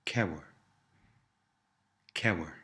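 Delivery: cewer starting with an aspirated K; hard K in cewer. Shown as a demonstration. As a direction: model the unsoftened K in cewer. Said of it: "softens the K sound at the beginning".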